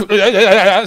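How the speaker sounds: laugh. Real, not acted. Laughing mid-sentence.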